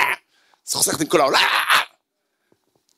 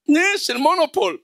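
surprised realization; 11 semitones higher than usual. Pretend. Spots a fake Monopoly bill.

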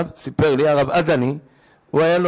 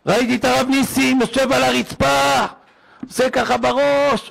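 alarmed report; 6 semitones higher than usual. Retold or imitated. Shocked son telling his father what he saw, high alarm. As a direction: pour it out in mock panic, pitched high.

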